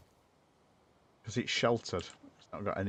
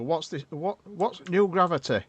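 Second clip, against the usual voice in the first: broad Yorkshire, higher and louder; hammed-up Yorkshire demo; proper Yorkshire accent; 10 semitones higher, 8 dB louder than usual. Shown as a demonstration. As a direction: lay on the Yorkshire vowels, play it up. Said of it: "if I was saying it in a proper Yorkshire accent".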